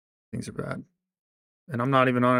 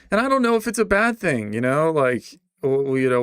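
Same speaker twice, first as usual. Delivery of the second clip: heated outburst; 5 semitones higher, 5 dB louder than usual. Real, not acted. Heated rant about his anger.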